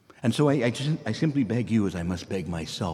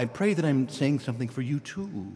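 ordinary talk, nothing marked

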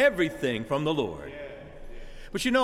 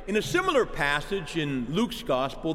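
ordinary delivery

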